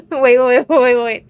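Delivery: loud excited repeated wait; excited shouting. Real, not acted. Excited realization while playing.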